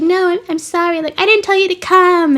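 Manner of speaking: high-pitched acted plea; pleading apology. Alexis runs outside after Daniel, mock pleading apology. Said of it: "and she's like".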